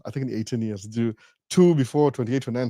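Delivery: ordinary delivery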